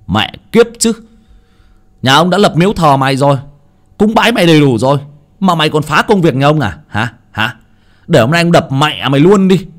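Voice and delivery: high, fast, furious ranting; furious cursing. A narrator's reading. Hảo rages at the shrine while smashing it, furious cursing. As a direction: curse the shrine with raging contempt. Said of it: "Vừa chửi trong cơn tức giận".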